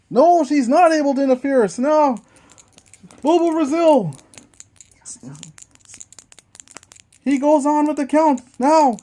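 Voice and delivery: lively ringside play-by-play; wrestling commentator. Acted. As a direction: call the match like a TV announcer. Play-by-play of dice wrestling match.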